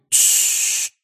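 long hissed shush; frightened shushing. A narrator's reading. Hermione cutting Ron off mid-word, fearful shush. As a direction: cut him off with an urgent shush. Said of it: "испуганно озираясь, оборвала его Гермиона".

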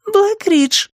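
put-on very high-pitched girl saying БЛЭК-РИДЖ; high girlish БЛЭК-РИДЖ; girl's echo. A narrator's reading. Nori echoes Joe's answer.

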